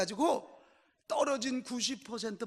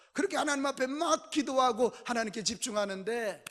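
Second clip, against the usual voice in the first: ordinary talk, nothing marked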